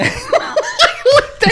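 squealing laugh; high-pitched laugh. Real, not acted. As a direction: burst into a high giggle. Reacts to joke.

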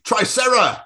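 slow, high, laughing dinosaur announcement; impactful intro. Acted. Tom announces dinosaurs for an impactful start, slow. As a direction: drag out the name for drama. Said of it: "Make it impactful".

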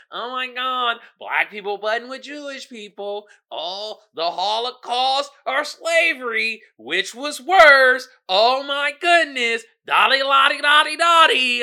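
over-the-top mocking voice building to a shout; mock dramatic gasp. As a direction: ham up the melodrama, ending in a gibberish shout. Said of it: "It's like".

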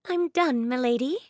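put-on pleased servant girl voice; maid's voice. A narrator's reading. Maid Annabeth voiced in story.

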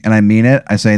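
ordinary delivery

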